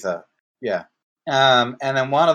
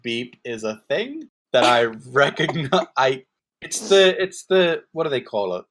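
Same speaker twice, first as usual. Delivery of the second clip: ordinary delivery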